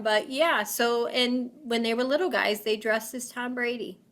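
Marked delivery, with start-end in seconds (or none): none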